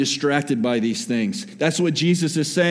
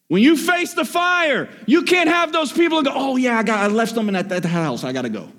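preaching at full force; 7 semitones higher than usual. Acted. Preacher urging the church to face fire together, impassioned. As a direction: push it to the back row.

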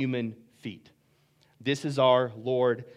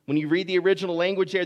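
ordinary talk, nothing marked

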